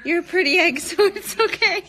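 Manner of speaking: laughing through a teasing line. Real, not acted. To a viewer, laughing while teasing. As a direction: giggle out the reassurance.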